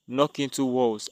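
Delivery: ordinary delivery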